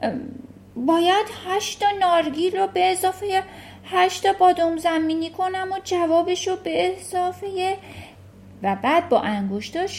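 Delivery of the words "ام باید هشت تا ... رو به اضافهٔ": higher, a touch panicky pleading; frightened pupil's voice; flustered boy. Acted. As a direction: stammer through the sum, lost. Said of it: "کاملاً گیج شده بود گفت".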